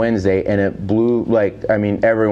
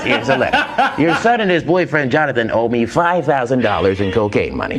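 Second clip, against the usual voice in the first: Casey Kasem; 3 semitones higher than usual. Retold or imitated. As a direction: present the letter like a radio dedication. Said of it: "He goes".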